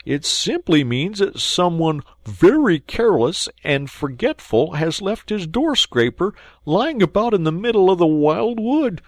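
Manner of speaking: huffy Mole. Acted. Mole grumbling to Rat about litter, huffy. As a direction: grumble about careless folk.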